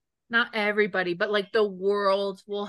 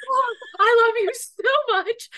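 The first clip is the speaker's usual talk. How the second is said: high, loud swooning gush; mock swoon; 6 dB louder than usual. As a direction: gush like a smitten fangirl. Fangirl swooning at the character.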